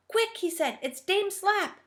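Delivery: high, urgent warning; alarmed. Acted. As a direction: hurry them away in fright. Moonface spots Dame Slap.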